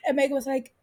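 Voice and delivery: ordinary talk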